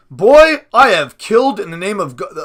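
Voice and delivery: animated father. A narrator's reading. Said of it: "came alive when he read".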